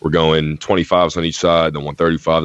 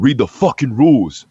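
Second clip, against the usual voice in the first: higher, louder retold outburst; mock yell; 8 semitones higher, 6 dB louder than usual. Retelling the angry guy pointing lifters to the sign, mock yell. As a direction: mimic an indignant gym complainer yelling. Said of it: "he literally just yells out".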